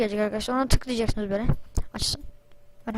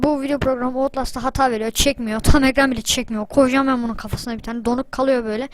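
usual speaking voice

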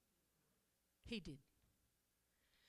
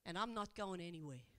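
tender reassurance; 3 semitones lower than usual. Acted. Jesus speaks tenderly to woman.

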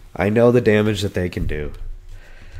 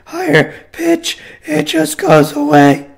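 high, loud strained voice that fails; voice giving out; 8 dB louder, slower than usual. Shown as a demonstration. Sick speaker showing how high notes vanish, voice giving out. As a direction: push up high on a voice that's gone. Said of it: "As soon as I try to go in a higher".

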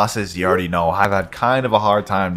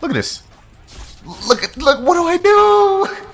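louder and higher with every look at this; rising disbelief; 11 semitones higher, 4 dB louder than usual. Real, not acted. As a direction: pitch climbs higher and higher into a disbelieving yelp. Boss keeps coming back.

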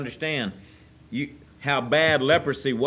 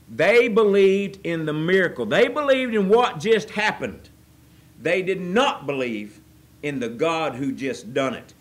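preaching at full force; 2 semitones higher, 4 dB louder than usual. Acted. Preacher pressing the congregation about the nine, loud and fervent. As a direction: drive the point home.

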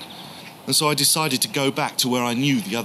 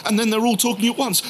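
usual speaking voice

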